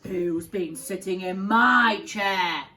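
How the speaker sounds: loud, slow gruff bear; Daddy Bear. Acted. Daddy Bear finding his porridge eaten, loud slow growl. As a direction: boom it out like a big father bear. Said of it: "said Daddy Bear".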